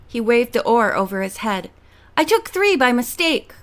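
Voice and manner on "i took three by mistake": excited. A narrator's reading. Continues triumphant shout.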